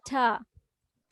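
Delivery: "ঠ" as a short, soft aspirated t; soft phonic T. Shown as a demonstration. Teacher demonstrating the letter T sound, soft airy "ঠ". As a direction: let "ঠ" out lightly with a puff. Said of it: "softly ঠ".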